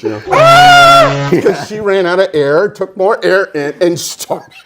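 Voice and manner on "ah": long high steady scream; boat horn scream. Retold or imitated. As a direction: hold one loud scream. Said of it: "like one of those boat horns".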